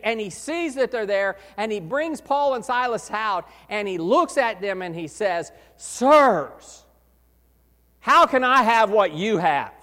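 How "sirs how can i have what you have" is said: loud pleading cry on Sirs, easing after; earnest plea. Retold or imitated. Jailer crying out to Paul and Silas, loud plea. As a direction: beg them for what they have.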